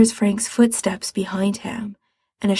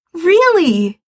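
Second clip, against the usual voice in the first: "Really!" higher, louder, falling; joyful confirmation; 8 semitones higher, 4 dB louder than usual. Acted. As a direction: answer "Really!" with excited certainty.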